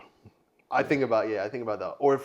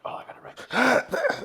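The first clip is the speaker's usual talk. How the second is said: high whisper; 13 semitones higher, 5 dB louder than usual. Retold or imitated. Quoted panicked thought.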